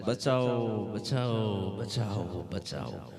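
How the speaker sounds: half-hearted, fading calls for help; retold cry for help, dying away; mock cries for help. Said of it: "માજી બૂમો પાડે છે".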